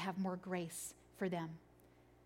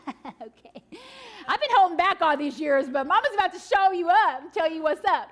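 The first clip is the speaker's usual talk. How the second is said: louder, higher playful threat; hammed-up mama ready to beat her kids at games; mock trash-talking mom; 7 semitones higher, 9 dB louder than usual. Mom to her cocky kids, playful mock threat. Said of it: "you're like".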